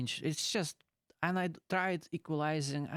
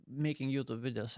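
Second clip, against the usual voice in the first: normal talking voice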